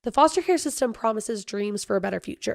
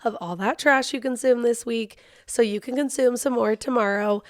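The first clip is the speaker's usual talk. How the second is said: podcast host intro; 6 dB louder than usual. Acted.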